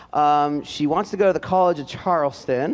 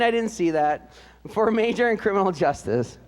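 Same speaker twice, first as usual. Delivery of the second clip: usual speaking voice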